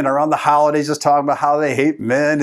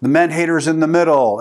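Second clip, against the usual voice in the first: ordinary delivery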